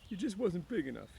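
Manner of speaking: a simple man grieving; lightly voiced Lenny, sorrowful; Lenny sad. A narrator's reading. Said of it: "he said".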